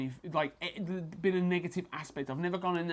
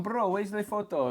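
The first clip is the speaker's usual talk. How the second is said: mock Eastern European accent; 6 semitones higher than usual. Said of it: "Eastern European fighters that would keep messaging".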